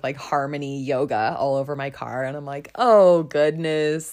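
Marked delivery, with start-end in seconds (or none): 2.7-4.1 a loud, slow, drawn-out oh goodness; mock dismay. Driving off after telling a woman to go home, loud mock dismay. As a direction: catch yourself in horror, hammed up. Dismayed at her yoga car.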